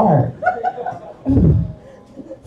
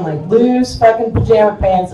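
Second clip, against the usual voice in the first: ordinary talk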